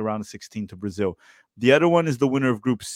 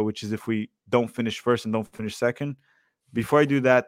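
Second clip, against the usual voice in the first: ordinary talk, nothing marked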